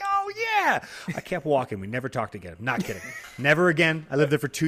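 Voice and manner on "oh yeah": much higher, sing-song yes; mock chirpy reply. Answering the neighbor in passing, mock chirpy high voice. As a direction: toss off a bright fake yes.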